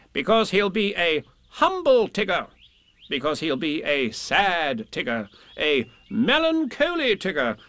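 high, stretched-out adjectives; bossy, emphatic Rabbit. Acted. Rabbit telling Pooh how Tigger will change, emphatic. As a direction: relish each point as you list it.

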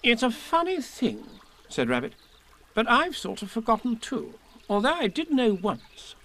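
high, slow, self-important; fussy Rabbit. Acted. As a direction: ponder it pompously. Rabbit character voice.

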